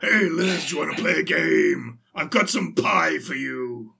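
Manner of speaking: lightly voiced creepy horror villain; a hint of a sinister game-master voice. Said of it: "Stop doing the voice".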